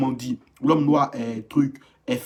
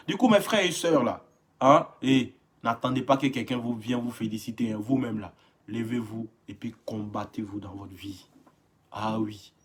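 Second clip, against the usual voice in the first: usual speaking voice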